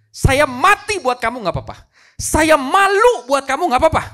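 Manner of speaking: loud, high, insistent appeal; impassioned acted plea; fervent fatherly pleading. King declares sacrifice for son.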